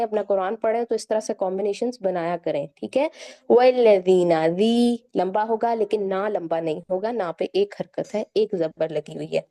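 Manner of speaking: normal talking voice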